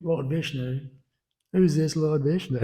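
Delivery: high-pitched, incredulous mock outrage; half-playful retold fury; mock furious. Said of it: "gets furious and he's like".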